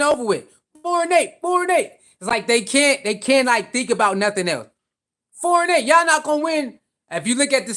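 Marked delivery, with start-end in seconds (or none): 0.8-1.9 mocking taunt. Mimicking critics again. 5.3-6.8 mocking taunt. Mimicking critics' taunt.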